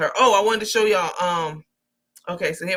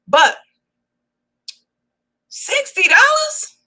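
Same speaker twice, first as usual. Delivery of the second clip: very high, slow disbelief; "sixty dollars?" rising in high disbelief; incredulous; 8 dB louder, slower than usual. Real, not acted. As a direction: stretch it out in shock. Outraged at her low price.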